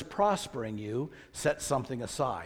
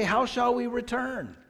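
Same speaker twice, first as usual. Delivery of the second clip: high-pitched quoted question; questioning retort; 8 semitones higher than usual. Acted. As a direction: throw the people's question back, pitched high. Voices the people's question back.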